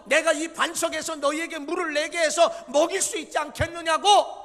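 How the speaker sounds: loud, high, furious scolding; angry rebuke. Acted. Moses raging at the complaining people, loud and high. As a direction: rage at a faithless crowd. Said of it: "그리고 분노해요".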